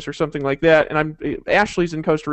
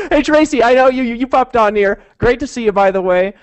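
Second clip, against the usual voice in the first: excited; 5 semitones higher, 6 dB louder than usual. Real, not acted. Greeting viewer excitedly.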